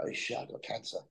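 a shocked whisper to himself; hushed whisper of dread. Retold or imitated. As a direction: breathe it out like a private thought you dare not say aloud. Retold thought on hearing of cancer.

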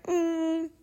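high-pitched, put-on doubting voice; high mock-skeptical hum and question; nagging inner doubt. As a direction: pipe up like a little voice of self-doubt. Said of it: "Its little ugly head rears up and it's like".

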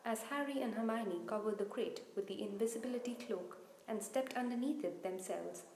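ordinary delivery, nothing marked

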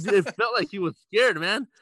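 loud, high "Dude"; animated emphasis; excited storytelling. Real, not acted. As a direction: tell it all worked up. Excited retelling.

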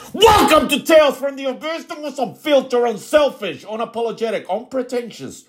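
high, booming show-intro announcer; hype announcer. Acted. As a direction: shout the welcome like a ring announcer.

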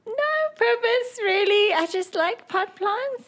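very high little character voice; naive Oriole. Acted. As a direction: answer innocently, pitch held way up high. Oriole answers the gnome meekly.

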